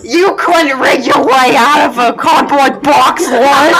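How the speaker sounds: character voice. Acted. In-character insult to rigger.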